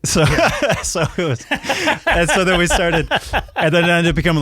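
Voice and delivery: high, laughing through the words. Real, not acted. Storyteller moving on after his punchline, laughing. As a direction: try to carry on while cracking up.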